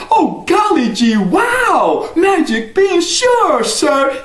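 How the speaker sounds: put-on very high, laughing, excited boy; thrilled boy. Jack is thrilled by the magic beans, high and laughing. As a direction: squeal with delight.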